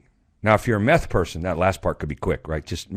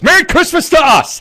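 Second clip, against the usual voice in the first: put-on much higher, louder child's shout; kid's cheer; 13 dB louder than usual. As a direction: belt it like a thrilled little boy. Son's cheer voiced in story.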